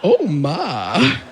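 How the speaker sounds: put-on big swooping pitch, Southern old-lady drawl; Southern elderly lady. Mother shocked by daughter's date, Southern elderly lady accent. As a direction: exclaim it like a shocked Southern matron. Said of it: "like he's a Southern elderly lady".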